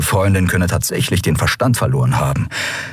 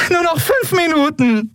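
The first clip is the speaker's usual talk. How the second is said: very high, whining plea; high mock-desperate pleading; begging. A narrator's reading. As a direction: beg in a wailing voice far above normal. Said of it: "bettelte sie den Kaiser an".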